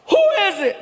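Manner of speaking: high yell through the door; mock yell from inside. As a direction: bark out who's there. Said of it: "He yells".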